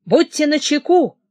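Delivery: окликнуть охрану громче и выше; тревожный окрик часовым; крик. A narrator's reading. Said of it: "крикнул он охранникам". (крикнуть охране, предупреждая об опасности)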